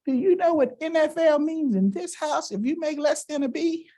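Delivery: high, stern mother scolding. Retold or imitated. Mom scolding her son in front of the coaches, stern and high. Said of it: "she says".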